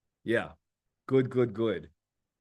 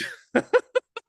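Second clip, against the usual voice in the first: squealing laugh, real; a real high laugh at the story; high burst of laughter; 8 dB louder than usual. Real, not acted. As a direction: laugh out loud, high and bright. Laughing about the nausea.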